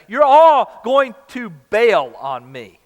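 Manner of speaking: loud and very slow; slow, loud punchline of the warning; emphatic paraphrase. Acted. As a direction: lean hard into every word for the congregation. Preacher paraphrasing Jesus' warning.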